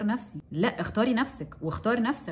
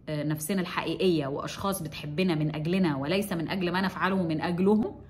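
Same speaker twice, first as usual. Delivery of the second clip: usual speaking voice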